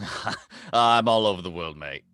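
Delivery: hammed-up Australian travel host; Aussie accent. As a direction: play the jolly Aussie guide with plenty of mate. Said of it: "with your accent".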